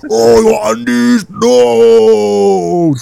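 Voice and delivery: long, high mock wail; hammed-up dying villain cry, slow and drawn out; defeated Death. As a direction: howl "no" like a beaten villain. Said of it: "Yeah, he was also".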